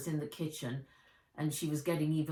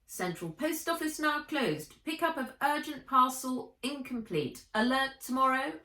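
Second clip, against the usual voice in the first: much higher mechanical pet voice; robot announcement; 8 semitones higher, 4 dB louder than usual. Acted. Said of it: "announced Fizz".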